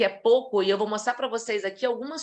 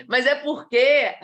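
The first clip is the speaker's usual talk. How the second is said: laughing; 5 dB louder, slower than usual. Real, not acted.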